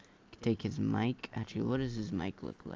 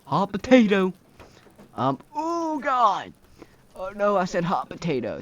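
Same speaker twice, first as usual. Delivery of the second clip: real yelling, much louder and higher; panicked shout; 9 semitones higher, 10 dB louder than usual. Real, not acted. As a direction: shout in sudden alarm, dragging oh god. Sets something on fire.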